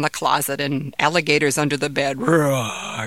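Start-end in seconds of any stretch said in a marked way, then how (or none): 2.2-3.1 a lightly voiced playful monster growl; mock monster roar. Father playfully growls at his son like a monster, a light mock growl. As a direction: growl like a pretend monster to make him laugh. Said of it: "growled in a scary voice".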